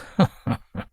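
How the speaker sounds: a short, wheezy laugh again; wheezing laugh. A narrator's reading. Old husband laughs again at his wife's years of knowing. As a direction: giggle helplessly through cracked lips. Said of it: "He began to laugh again".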